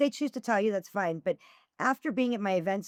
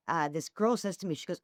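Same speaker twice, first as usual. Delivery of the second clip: ordinary delivery, nothing marked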